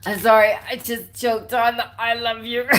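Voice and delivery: can't stop laughing mid-line; laughing through it. Real, not acted. Reader choking with laughter over the love card, giggling.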